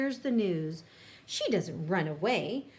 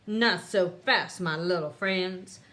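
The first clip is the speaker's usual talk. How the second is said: louder menacing villain line; snarl; 3 semitones lower, 5 dB louder than usual. A narrator's reading. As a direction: threaten the heroes with a snarl. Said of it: "a voice snarls".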